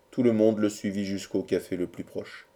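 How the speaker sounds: ordinary delivery, nothing marked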